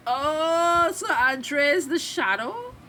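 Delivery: high, loud, slow questioning; astonished realisation. Real, not acted. Vlogger learning who the shadow is, real surprise. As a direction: stretch it out as the twist sinks in. Reacting to a reveal.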